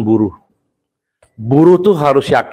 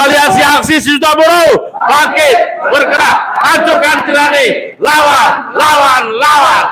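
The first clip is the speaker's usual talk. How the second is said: high shouted rally chant; fiery revolutionary speech; 13 semitones higher, 4 dB louder than usual. Real, not acted. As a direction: chant fight, fight, fight to the crowd.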